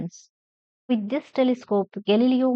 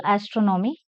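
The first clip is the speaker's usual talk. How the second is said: "astronomy" stressed on "tron"; third-last syllable stressed. Shown as a demonstration. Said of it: "stress the third syllable from the end".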